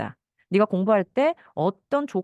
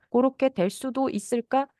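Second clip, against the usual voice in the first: slow, higher musing wonder; imagining wonder; 5 semitones higher, slower than usual. Shown as a demonstration. Counselor imagining the child's view, slow wondering. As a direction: wonder it aloud slowly. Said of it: "상상이에요".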